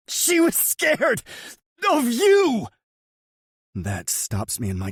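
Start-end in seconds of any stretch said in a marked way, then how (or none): 0.1-2.7 very high, louder, slow yell. Acted. Choked brother yells back the truth. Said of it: "he yells".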